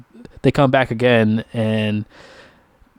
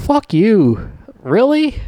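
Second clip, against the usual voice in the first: indignant; 9 semitones higher, much slower than usual. Real, not acted. Indignant reaction to returning characters.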